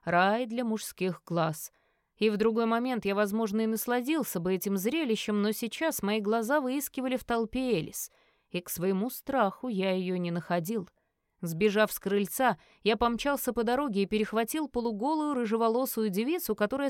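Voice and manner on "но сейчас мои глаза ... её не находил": plain reading voice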